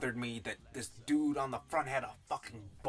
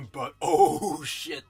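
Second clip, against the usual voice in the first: slow, loud laughing curse; laughing exclaim; 12 dB louder, much slower than usual. Real, not acted. Reviewer laughing to viewers about the book, slow loud laugh. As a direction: burst out laughing at how good it is.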